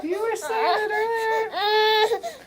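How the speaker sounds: very high slow, rising at end; sing-song baby talk. Pretend. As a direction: croon it again, ending up.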